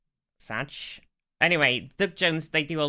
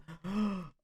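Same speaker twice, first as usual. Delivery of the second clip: mock gasp; 7 semitones higher, 5 dB quieter than usual. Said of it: "And I'm like".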